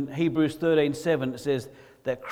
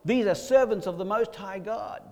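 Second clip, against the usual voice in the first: raised, higher proclamation; top of her voice; 6 semitones higher than usual. Retold or imitated. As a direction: proclaim it publicly, imitating the slave girl. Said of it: "proclaiming at the top of her voice".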